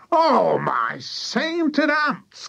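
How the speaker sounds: very high, long drawn-out exclamation; astonished cry. A narrator's reading. Mister Killycranky sees the chicken on stilts, astonished cry. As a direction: wail it slowly in disbelief. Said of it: "cried Mister Killycranky".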